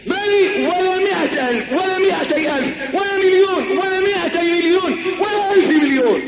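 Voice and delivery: high, driving sermon cry; impassioned preaching. Acted. Preacher warns that no fortune ever satisfies, high impassioned preaching. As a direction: pile up the list in a high, urgent preaching voice.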